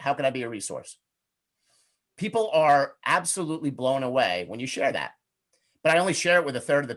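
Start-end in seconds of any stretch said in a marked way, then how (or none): 0.0-1.0 softer, lower, gentle question; soft sincere offer. Shown as a demonstration. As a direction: offer help quietly, like you mean it. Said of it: "that's exactly how I would say it".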